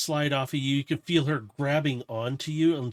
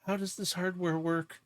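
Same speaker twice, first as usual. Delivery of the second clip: high, small character voice; nervous character; 5 semitones higher than usual. Acted. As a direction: distract yourself in a high, anxious voice. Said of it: "She goes".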